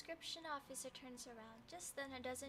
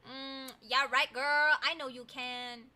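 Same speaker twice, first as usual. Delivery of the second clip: sarcastic; 14 dB louder, slower than usual. Real, not acted. Sarcastic teasing.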